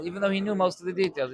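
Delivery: usual speaking voice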